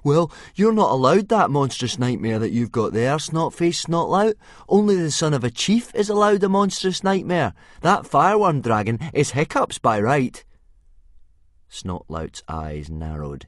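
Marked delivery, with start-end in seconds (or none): 0.0-10.4 high, loud defiant voice; defiant boy. Acted. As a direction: stand up to the bully. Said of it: "Fishlegs leapt to Hiccup's defence".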